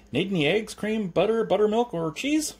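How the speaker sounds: high, insistent list of wares; cheery milkman. A narrator's reading. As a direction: sing out the list, rising at the end. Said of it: "said a cheery voice".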